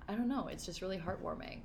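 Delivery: softly moved, voice close to tears; getting choked up while talking; touched. Real, not acted. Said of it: "I'm gonna get teary-eyed".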